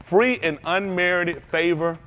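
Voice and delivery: slow, measured read of a definition. Acted. Preacher reading a definition to the congregation slowly. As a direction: lay each word down deliberately.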